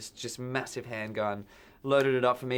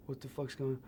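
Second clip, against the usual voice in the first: much softer, different voice, bewildered; stunned hush; 9 dB quieter than usual. Retold or imitated. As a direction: mutter it in shock. Confused under threat.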